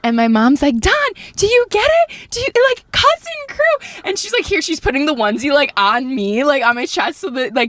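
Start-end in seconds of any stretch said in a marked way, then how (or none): 0.8-4.0 high, insistent prompting; high-pitched, stretched-out excited hint; imitated urgent mom. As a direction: mimic mom urging dad to catch on. Mom urging dad to get it.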